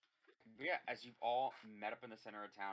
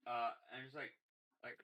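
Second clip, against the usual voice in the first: ordinary talk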